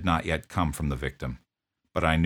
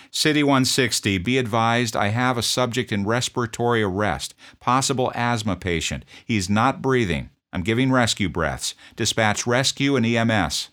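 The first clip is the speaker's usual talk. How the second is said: higher, louder urgent radio report; urgent radio call; 6 semitones higher, 5 dB louder than usual. Retold or imitated. Officer radioing dispatch for rescue and EMS, urgent loud call.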